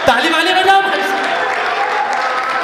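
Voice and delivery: high, loud and very slow stretched call; milk the crowd for applause; drawn-out applause call. Real, not acted. Asks audience for applause. (खींचकर ज़ोर से तालियों की पुकार)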